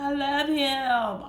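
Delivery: dreamy mock of her old self; naive younger self. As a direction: play your younger, smitten self. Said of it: "I was like".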